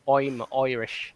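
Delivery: slow Irish "I'm Irish" with I's as "oy"; mock Irish accent. Shown as a demonstration. Panellist showing the Irish trick, mock Irish accent. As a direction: turn each I into "oy", cartoon Irish. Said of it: "replace all the I's with O's".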